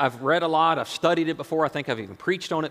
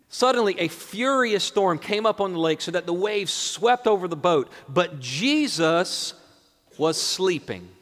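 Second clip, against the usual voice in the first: raised, emphatic Bible reading; preacher proclaiming the text; dramatic Scripture reading; 6 semitones higher, 5 dB louder than usual. Acted. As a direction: read the storm story out with weight. Reads the passage again.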